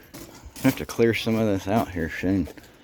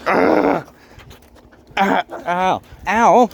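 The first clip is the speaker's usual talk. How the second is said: yelping in pain; 9 semitones higher, 6 dB louder, much slower than usual. Real, not acted. Dog biting him.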